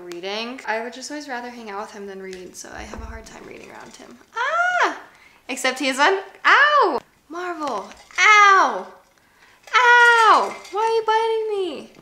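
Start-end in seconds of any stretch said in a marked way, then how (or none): 4.3-8.9 startled yelps. Real, not acted. Dog biting her. 9.7-11.9 high pet scolding. Real, not acted. Dog biting her.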